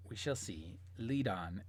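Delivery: low, soft villain voice; quiet, deep command to a guide; sinister woman. Acted. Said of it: "And she says".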